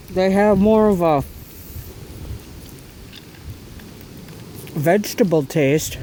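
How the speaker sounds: talking through a mouthful; chewing while he talks; mouth full. Real, not acted. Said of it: "sorry for talking with my mouth out".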